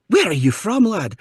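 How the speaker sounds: high, loud Scottish challenge; Scottish mom. Retold or imitated. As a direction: quiz him as a suspicious Scottish lady. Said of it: "cute little Scottish lady".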